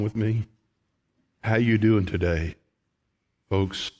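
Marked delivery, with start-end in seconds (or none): none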